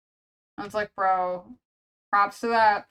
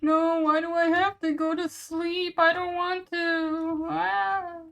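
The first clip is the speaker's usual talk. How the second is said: put-on higher, slow whiny child voice; whining child; 9 semitones higher, 3 dB louder, slower than usual. Streamer acting a kid refusing to sleep, slow high whine. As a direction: whine like a kid sent to bed. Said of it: "See, just like that".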